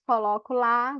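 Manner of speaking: ordinary delivery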